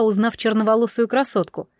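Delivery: plain reading voice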